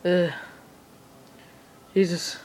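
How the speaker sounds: normal talking voice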